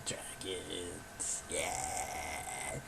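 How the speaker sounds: deep, low, rumbling dragon growl. Shown as a demonstration. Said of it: "okay, my dragon voice".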